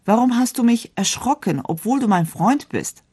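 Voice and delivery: high, startled question; a touch of shocked surprise at a friend; astonished. A narrator's reading. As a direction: ask in bewildered astonishment. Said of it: "fragte erstaunt".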